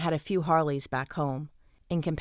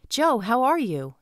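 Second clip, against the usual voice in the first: bright greeting; 7 semitones higher, 5 dB louder than usual. A narrator's reading. Greeting the bouncer warmly.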